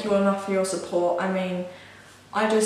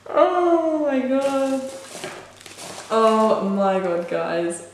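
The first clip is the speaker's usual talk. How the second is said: slow, stretched-out oh my god, higher at first; overjoyed; 3 semitones higher, much slower than usual. Real, not acted. Vlogger gushing over her new camera, slow, stretched-out delight. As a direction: savour each word in delight.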